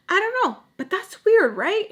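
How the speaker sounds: wide-eyed retelling; high incredulous. Retold or imitated. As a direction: squeak it out in disbelief. Retold question to mom.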